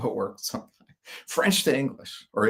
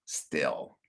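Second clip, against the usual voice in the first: whispered aside; 8 semitones lower, 8 dB quieter than usual. Real, not acted. Aside after Chicago mentality.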